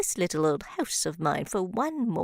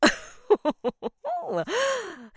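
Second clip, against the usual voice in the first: falling witch laugh; cackle, amused at the idea; 9 semitones higher, 3 dB louder than usual. Acted.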